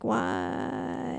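soft, drawn-out mock moan; mock dismayed whine. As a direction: let the word sag out quietly. Voicing the woman's pained reply.

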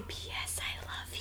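soft whispered love note. Retold or imitated. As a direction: barely breathe the words. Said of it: "whispering to her".

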